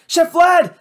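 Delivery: high, loud worried call; cried out. A narrator's reading. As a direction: Matt calls toward the kitchen, loud alarmed cry. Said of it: "I cried".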